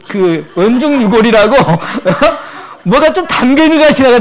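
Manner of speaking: high, loud talk broken by laughter; laughing through words. Real, not acted. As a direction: tell it while laughing. Laughing while telling story.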